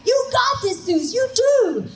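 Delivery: high, loud, eager cheering voice; eager puppy hype men. Retold or imitated. The boys cheering Suze on like puppies, high and loud. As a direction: gush like an overexcited puppy. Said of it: "like two adorable Labrador puppies going".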